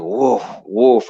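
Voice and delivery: two quick woofs in a row; storyteller's woofing dog; imitated dog bark. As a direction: bark like a big guard dog. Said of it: "that dog's like".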